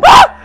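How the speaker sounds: short, high, loud yelp; startled yelp. Real, not acted. Player spots Skeleton Man, startled yelp. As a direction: cry out in sudden fright.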